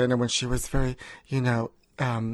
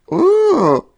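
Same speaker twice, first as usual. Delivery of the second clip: high squeal of delight; 9 dB louder than usual. Acted. Preening on FaceTime in skit.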